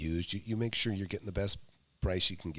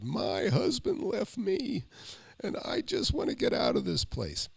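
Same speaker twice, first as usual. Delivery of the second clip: high, slow, lightly imitated crying; a hint of a weeping seller; a mild imitation of a seller in tears; retold tearful plea; 13 semitones higher, slower than usual. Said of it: "burst into tears".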